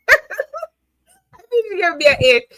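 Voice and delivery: high, drawn-out words through laughter; real laughing. Real, not acted. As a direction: laugh your way slowly through the line. Laughs while repeating her score.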